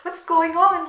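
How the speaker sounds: imitating her frightened younger self; panicked kid. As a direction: squeal it like a scared kid hearing ambulance. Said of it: "And I was like".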